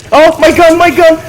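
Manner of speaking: loud, high yelp of alarm; panicked cry. Real, not acted. Gun trouble during zombie attack.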